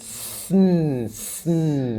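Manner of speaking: "sn" as a hiss straight into a long hummed n; syllabic N. Shown as a demonstration. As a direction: hold s, then n, no vowel between in "sn". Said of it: "N takes the place of a vowel".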